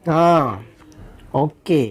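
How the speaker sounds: ordinary delivery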